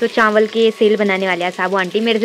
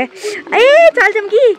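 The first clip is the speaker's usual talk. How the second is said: high, loud, slow startled cry; 10 semitones higher, 5 dB louder, much slower than usual. Real, not acted. Vlogger startled by lightning outside, surprised cry. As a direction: jump at the flash.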